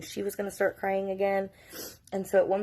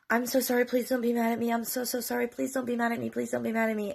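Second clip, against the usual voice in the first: higher-pitched run of apologies; imitated pleading; 6 semitones higher, faster than usual. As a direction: beg forgiveness as an imitated daughter. Daughter begging forgiveness, retold.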